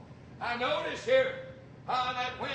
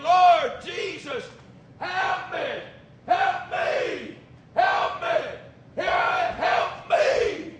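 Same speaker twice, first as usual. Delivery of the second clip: loud, higher hoarse cries for help; desperate shouting; 5 semitones higher, 10 dB louder, much slower than usual. Acted. Bartimaeus shouting to Jesus for help. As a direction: scream for help again and again. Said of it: "He's saying".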